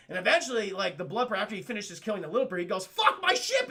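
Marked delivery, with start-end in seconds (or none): 2.9-3.7 high, loud panicked cry; hammed-up yell of dismay; alarmed mock shout. Predator alarmed about his ship.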